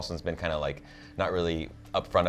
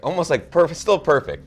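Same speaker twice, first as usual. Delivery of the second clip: higher and louder, amazed; excited; 11 semitones higher, 9 dB louder than usual. Real, not acted. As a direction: gush about it, rising up. Excited about perfect hair.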